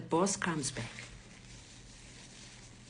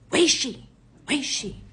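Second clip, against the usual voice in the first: loud, high, urgent question; alarmed demand; 7 semitones higher, 7 dB louder than usual. Retold or imitated. Robbers all rush to find the person, loud urgent demand. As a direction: act out rushing robbers demanding an answer.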